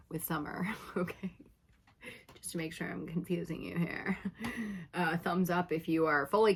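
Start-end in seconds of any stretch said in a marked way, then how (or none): 0.1-4.2 giggly whisper. Real, not acted. Giggling aside after a joke.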